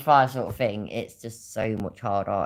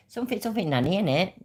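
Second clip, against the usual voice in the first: lightly voiced granddaughter, pitched up; granddaughter's banter; 7 semitones higher, much faster than usual. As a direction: imitate a granddaughter saying "innit". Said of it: "but she said".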